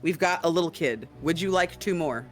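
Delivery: ordinary delivery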